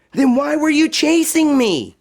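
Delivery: put-on high, small voice of a worried girl; Josephine despairing. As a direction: sound defeated at the foot of a mountain. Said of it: "asked Josephine".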